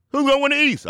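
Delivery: high, urgent preacher's call; hyped question. Acted. The Rev voices the East question, high hyped call. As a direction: throw it out high to the crowd.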